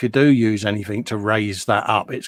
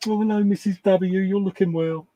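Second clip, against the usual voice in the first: higher, mock charming greeting; imitating Dave; 8 semitones higher than usual. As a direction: send up a friend's sweet talk, pitched up. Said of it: "Dave goes".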